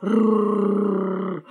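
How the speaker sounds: low, rolling dog growl. Acted. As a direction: growl like a big shaggy dog. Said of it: "зарычал пёс".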